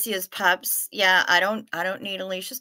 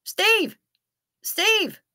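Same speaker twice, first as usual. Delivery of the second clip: calling out; 11 semitones higher than usual. Pretend. Calling out to a friend.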